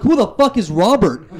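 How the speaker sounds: indignant comeback. Retold or imitated. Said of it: "I'm like".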